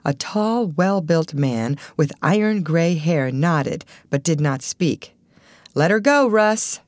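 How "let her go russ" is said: raised, louder director's cue; brisk exclaimed order; exclaiming. A narrator's reading. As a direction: call out the go signal, pitched up. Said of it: "Mister Pertell exclaimed".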